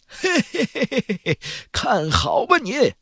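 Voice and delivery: put-on high, far-off hearty old man's voice; hearty old spirit. A narrator's reading. Old master boasting to his junior, high hearty voice. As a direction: boom it out like a proud ancient master.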